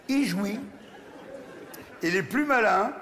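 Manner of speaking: ordinary talk, nothing marked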